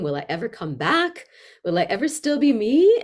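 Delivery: student afraid of going too far, pleading; mock worried plea. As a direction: voice a student's fear of losing herself. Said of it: "We think".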